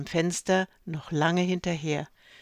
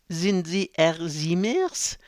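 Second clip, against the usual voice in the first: put-on "Siemers" stressed on its last syllable, slow French accent; slower than usual. A narrator's reading. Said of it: "Die Betonung lag auf der letzten Silbe".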